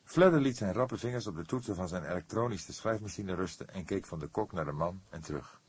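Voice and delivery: plain reading voice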